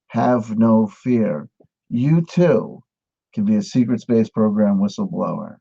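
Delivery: ordinary talk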